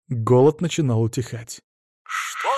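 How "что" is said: frightened. A narrator's reading. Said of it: "испуганный, нечеловеческий голос".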